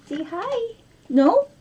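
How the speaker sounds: high sing-song voice to the dog; high coaxing pet talk. Real, not acted. Owner coaxing her dog to say hi, high pet voice.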